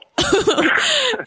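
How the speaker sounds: a burst of high delighted laughter; high squealing laugh. Real, not acted. Laughing at own imitation.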